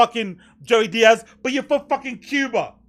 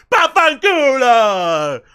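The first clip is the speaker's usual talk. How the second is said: mock Italian; 5 dB louder, much slower than usual.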